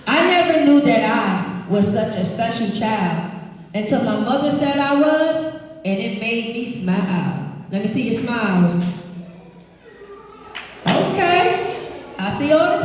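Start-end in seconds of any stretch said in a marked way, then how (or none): 0.1-7.5 put-on expressive read-aloud to kids; reading to children. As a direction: read it warmly to the class. Reading picture book aloud.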